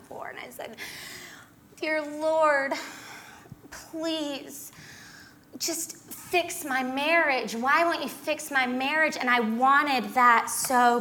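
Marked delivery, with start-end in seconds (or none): 1.8-9.2 lightly voiced desperate prayer, slow and higher; a hint of tearful pleading prayer; dramatic prayer. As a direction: plead with God a little theatrically, higher. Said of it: "self-righteously praying".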